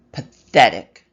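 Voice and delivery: loud, sudden sneer; loud contemptuous bark; scornful shout. Acted. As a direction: spit the word at her. Mirror voice spits an insult.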